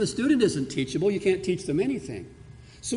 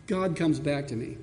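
normal talking voice

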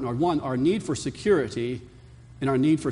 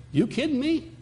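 higher, disbelieving retort; incredulous; 9 semitones higher than usual. Retold or imitated. Pastor retorting to the scientist's quote, incredulous and higher. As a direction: toss it back in disbelief. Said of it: "And I want to say".

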